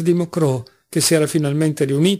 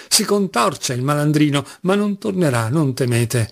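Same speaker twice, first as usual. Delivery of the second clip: usual speaking voice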